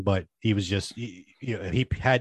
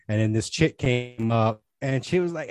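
normal talking voice

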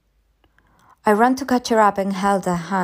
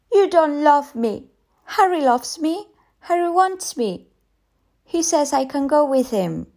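put-on high-pitched child's accusation; accusing little girl; 8 semitones higher than usual. A narrator's reading. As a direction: accuse as a hurt small girl. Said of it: "Then she said".

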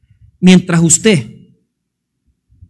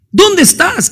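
pitched higher, pressing call; God calling again, higher and urgent; 8 semitones higher, slower than usual. Acted. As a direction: lift it up and press harder. Preacher calls out God's question.

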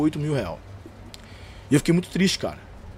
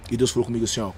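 ordinary delivery

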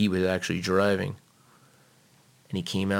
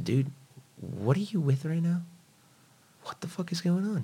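reenacted alarmed questioning, pitched way up; incredulous confronting; 10 semitones higher, 3 dB quieter than usual. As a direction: plead for an explanation in a high voice. Retells confronting brother about girlfriend.

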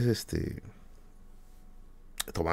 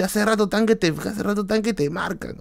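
retold yell; 10 semitones higher than usual. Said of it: "y el tío me grita".

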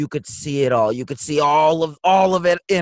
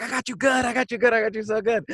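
higher playful gloat; 6 semitones higher than usual. Retold or imitated. Son retells teasing his mom, mildly high gloat. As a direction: rub the prank in. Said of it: "but I was like".

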